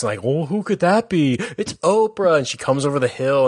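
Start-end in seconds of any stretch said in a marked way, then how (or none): 0.2-2.4 big reveal in an Oprah voice. Retold or imitated. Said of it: "you hear Oprah's voice".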